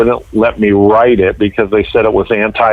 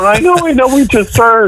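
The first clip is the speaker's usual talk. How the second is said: much higher, laughing imitation of a flustered dispatcher; mock panicked operator; 12 semitones higher than usual. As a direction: play the nervous 911 operator, half laughing. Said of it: "she's panicking".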